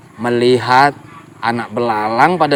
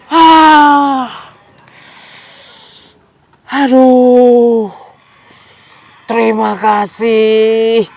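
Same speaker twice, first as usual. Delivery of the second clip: relieved crocodile; 6 semitones higher than usual. Acted. Crocodile voice, pained then grateful.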